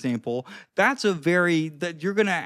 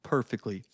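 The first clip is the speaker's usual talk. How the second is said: "perfectly" set apart, low and soft; weight the word "perfectly" by dropping it lower and quieter; emphasis on "perfectly"; 6 semitones lower, 5 dB quieter than usual. Shown as a demonstration. Coach showing viewers the key word, emphasized low. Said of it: "I'm emphasizing the word perfectly".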